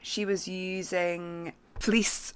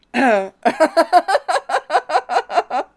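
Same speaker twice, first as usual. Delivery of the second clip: giggling fit breaks through; real laughing fit; high helpless laughter; 10 semitones higher, 11 dB louder than usual. Real, not acted. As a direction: laugh you can't hold back. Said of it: "try not to laugh".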